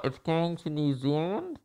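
soft, muffled repeat through a mask; indistinct, as if through thick cloth. Retold or imitated. Customer repeating himself to the clerk, muffled by mask. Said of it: "makes it twice as muffled".

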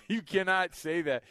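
slow, laughing objection; laughing protest. Real, not acted. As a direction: protest through helpless laughter. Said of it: "You're sitting here laughing".

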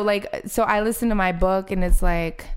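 ordinary talk, nothing marked

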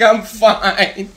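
high mock weary boy; a hint of a tired kid brushing it off; sick kid. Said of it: "he's like".

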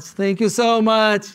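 grateful, stretched-out thanks to the crowd; delighted repeat thanks; emphatic thanks. Real, not acted.

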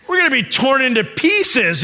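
high, loud alarmed cry; acted panic. Brothers reacting to Joseph's reveal, loud acted panic. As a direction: cry out as if doomed.

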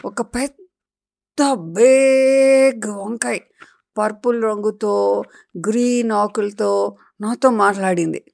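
plain reading voice

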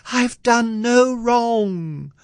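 high, slow, drawn-out wail; pleading cry. A narrator's reading. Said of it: "She cried again".

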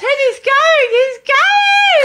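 retold panic, half-laughing; panicked shout. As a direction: yell in panic as the dog bolts. Retelling panic on dog walk.

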